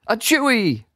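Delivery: high spoken sneeze. A narrator's reading. Sewer rat sneezing in the silent room. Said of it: "sewer rat number one sneezed".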